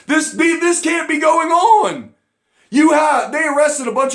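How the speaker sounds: high indignant yell; outraged shout. Real, not acted. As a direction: rage at the absurdity. Outraged disbelief continues.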